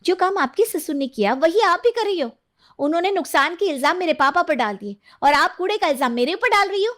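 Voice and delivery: high, accusing woman's voice, rising further; staged quarrel. Pretend. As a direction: throw the blame back in mock indignation. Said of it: "झूठी लड़ाई करती हैं". (झूठी लड़ाई में ऊँचे स्वर का आरोप)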